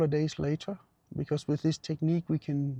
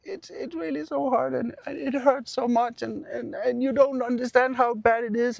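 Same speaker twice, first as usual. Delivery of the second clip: high, strained mock whimper from a hurting patient; hammed-up high-pitched complaint; imitating distressed patient; 8 semitones higher, 5 dB louder than usual. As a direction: act out cramping up in pain, pitch pushed way up. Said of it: "she started to do this".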